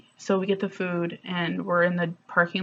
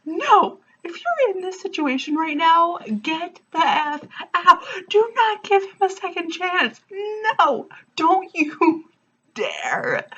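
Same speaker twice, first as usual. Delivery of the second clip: urgent warning; 11 semitones higher than usual. Real, not acted. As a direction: warn them off, voice pitched way up. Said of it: "urgent plea to viewers".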